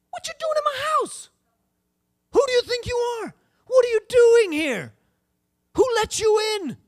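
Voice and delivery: high, loud volley of demands; indignant shouted questions; outraged confrontation. Acted. Confronting intruder in your house.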